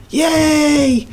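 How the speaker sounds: put-on high little cheer of delighted kids. Kids cheering at their father's promise, high and excited. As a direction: cheer like the kids just heard about the park.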